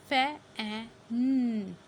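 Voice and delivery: loud, slow फैन with hummed final n; sounded-out short A. Shown as a demonstration. Teacher shows the short vowel, sounding out फैन. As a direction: break फैन into "fa - a - nnn". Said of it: "फे ए की sound".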